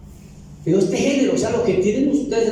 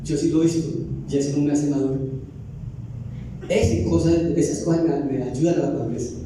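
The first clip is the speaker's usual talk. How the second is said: ordinary delivery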